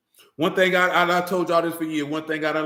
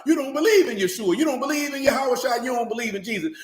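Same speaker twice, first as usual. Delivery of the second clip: higher, louder angry scolding; heated retold rebuke; 7 semitones higher, 3 dB louder than usual. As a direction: lay into him like you did back then. Retold heated argument with a man.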